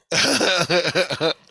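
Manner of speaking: high, loud burst of laughter. Retold or imitated. Robed guide in the hallway laughing at Richard's question.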